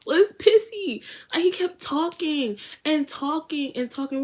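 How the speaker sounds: high, loud outburst; laughing exasperation about him; exasperated. Real, not acted. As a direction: blurt his name in disbelief.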